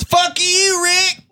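a hammed-up Southern shout at a buddy. Teasing his friend Rick with a mock Southern shout. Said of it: "That's your best Southern voice".

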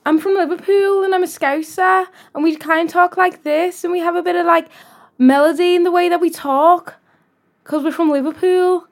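high, lilting Liverpool accent; Scouse accent. Shown as a demonstration. Co-host asked for Liverpool, put-on Scouse accent. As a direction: play up the melody of Scouse talk. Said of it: "All right, give me Liverpool".

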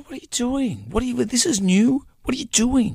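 high-pitched retold disbelief; mock-incredulous squeak; exasperated. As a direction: act out baffled nagging, voice pushed high. Acts his exasperated reaction to wife.

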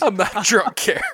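high, mock drunk uncle. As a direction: repeat the drunk uncle line. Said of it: "sound like my Uncle Richie".

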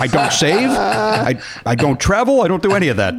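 normal talking voice

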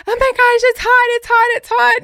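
very high, loud frantic squeal; shrieky retold alarm, high and loud; mock burning panic. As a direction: imitate someone yelping from a burn. Acting out patient being burned.

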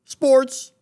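high, punchy segment announcement; announcer voice. Pretend. Announcing the sports segment to a cohost in a punchy voice. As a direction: hype the word Sports like a radio host.

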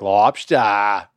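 put-on LOBSTER as a drawn-out "lobstah" in a Maine accent; imitating a kid's fake Maine accent; hammed-up Maine accent, dropped final R; phony Maine accent. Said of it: "he said it with a phony, like, Maine accent".

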